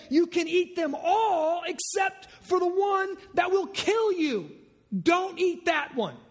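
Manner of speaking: a gentle father laying down one rule; voice of God. Acted. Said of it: "He said".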